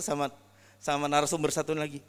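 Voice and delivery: ordinary delivery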